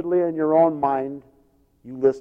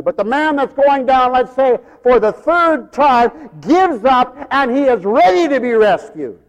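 forceful preaching; 8 semitones higher, 5 dB louder than usual. Real, not acted. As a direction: preach it loud and high, stretching the key words.

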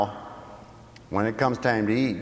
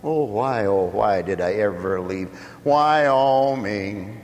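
hammed-up wailing regret, higher and louder; mock lament; 7 semitones higher, 4 dB louder, slower than usual. Preacher voicing Simon moaning about leaving home. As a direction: moan it like a homesick man.

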